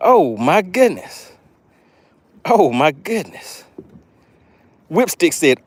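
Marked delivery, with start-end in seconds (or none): none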